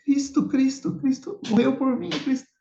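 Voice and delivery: high, overwhelmed cry of sudden understanding; tearful outcry. Retold or imitated. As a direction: cry his name out, choking with emotion. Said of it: "começou a chorar". (grito choroso e agudo, emocionado)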